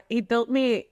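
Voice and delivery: usual speaking voice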